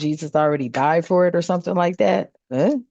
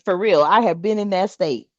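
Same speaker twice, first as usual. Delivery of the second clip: ordinary delivery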